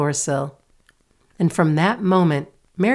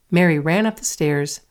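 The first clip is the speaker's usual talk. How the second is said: ordinary delivery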